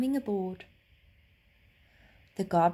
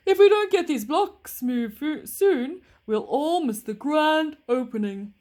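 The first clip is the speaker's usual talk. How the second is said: loud, higher anxious driver; train driver; 6 semitones higher, 9 dB louder than usual. A narrator's reading. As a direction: announce the bad news as a worried driver.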